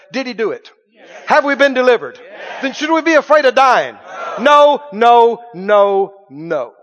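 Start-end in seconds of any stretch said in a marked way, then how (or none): 2.6-6.7 loud rising "No, no"; preacher's shouted answer. Acted. Preacher shouting the answer about fear of dying to the church. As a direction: answer your own question with a shout.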